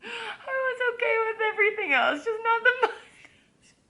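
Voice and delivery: high, loud laugh-crying; helpless laughter near tears. Real, not acted. Laughing at the fake tan mustache, high and loud.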